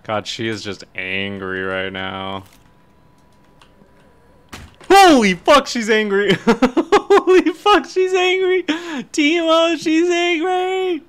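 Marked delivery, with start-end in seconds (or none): 4.9-11.0 very high, loud scream with laughter breaking in; high-pitched panicked yelling. Real, not acted. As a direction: shriek in real alarm at a sudden attack. Ghost suddenly attacks in game.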